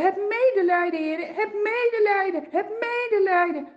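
high, loud begging cry; calling out. Acted. Blind beggar calls out to Jesus, high and loud. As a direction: cry out for mercy across the crowd. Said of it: "te roepen".

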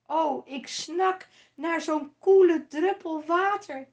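loud, high, begging voice; desperate high loud plea from the fire; rich man pleading. A narrator's reading. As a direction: beg for one drop of water. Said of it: "riep de rijke man".

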